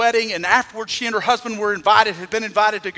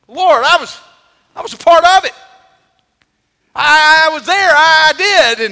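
desperate plea; 8 semitones higher, 11 dB louder than usual. Retold or imitated. People pleading before the Lord.

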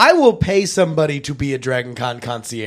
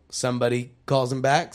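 normal talking voice

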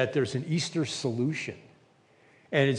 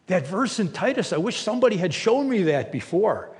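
revelation; 6 semitones higher, 4 dB louder than usual. Retold or imitated. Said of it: "It was like a revelation".

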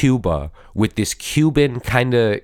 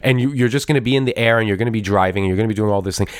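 usual speaking voice

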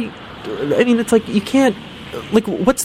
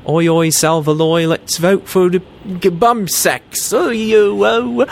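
mock Australian accent; 5 semitones higher, slower than usual. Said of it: "That's how they talk there".

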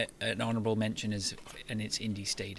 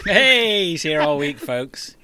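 comedy club MC; 6 semitones higher, 10 dB louder, much slower than usual. Pretend. Mock comedian heckle.

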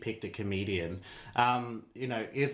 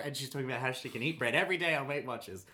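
ordinary talk

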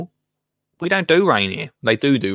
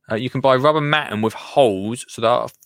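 normal talking voice

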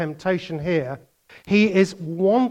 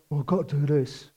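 quick, softer, put-upon grumble; reluctant duty; 5 semitones lower, 7 dB quieter, faster than usual. Retold or imitated. Preacher contrasts grudging obedience, softer grumble. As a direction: sound dutifully resigned.

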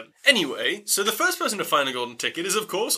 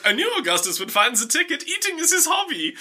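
high-pitched mock mum voice; proud mum; 10 semitones higher than usual. Augustus's mum boasting to the media, high mock pride. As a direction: boast as his mother, climbing higher. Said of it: "And his mum's like".

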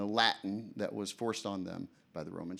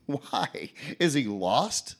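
mocking laugh; 4 semitones higher, 6 dB louder, much slower than usual. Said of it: "The people cried out laughingly".

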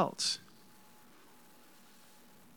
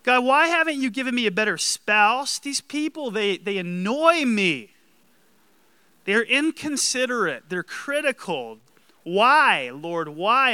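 higher, louder mock whine; hammed-up self-pity, pitched up and loud; whiny self-pitying prayer; 6 semitones higher, 7 dB louder than usual. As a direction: complain to God like a spoiled child. Parodying churchgoers' grumbling prayers.

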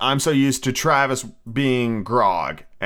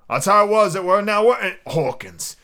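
higher, eager sailor's voice; old sea dog; 6 semitones higher than usual. Acted. Long John asking Hawkins to back his story, as a sea dog. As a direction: seek agreement as a salty old seaman.

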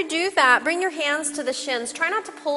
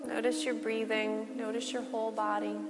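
soft spa whisper; 10 dB quieter than usual. Acted. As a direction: lull them into rest. Shavasana guided relaxation.

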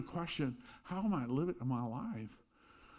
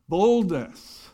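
proclaimed point; 6 semitones higher, 13 dB louder than usual. Real, not acted.

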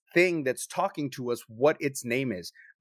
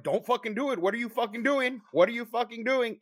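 higher, urgent self-warning; panicked inner voice; 8 semitones higher than usual. Retold or imitated. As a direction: plead with yourself not to do it. Panicked inner voice, opening salt.

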